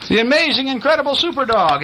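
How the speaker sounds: high-pitched showy announcement; excited announcing. Acted. Little girl's sign presents her dog, high excited announcement. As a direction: announce it high, like a star act.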